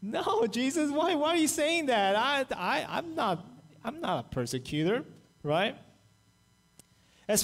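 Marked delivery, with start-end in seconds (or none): 0.0-5.0 higher, stammering disbelief; taken aback, pleading innocence; mock shocked denial to Jesus, pitched up; shocked protest. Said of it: "I'm like".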